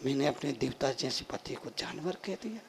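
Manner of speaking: low, hushed regret; quiet remorse. Acted. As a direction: sink into soft self-reproach. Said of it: "उसको बड़ा पश्चाताप हुआ". (धीमा, पछतावे भरा स्वर)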